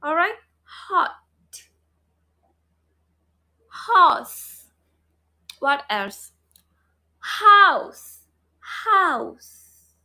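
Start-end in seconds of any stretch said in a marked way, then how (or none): 0.6-4.6 "hot" and "Horse" with a strong puff on H; higher model words led by breath; airy H. Shown as a demonstration. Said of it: "feel the air from your mouth". 7.2-9.9 "House" raised and drawn out, H blown out; high, emphatic model of "House"; airy H. Shown as a demonstration. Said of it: "feel the air from your mouth".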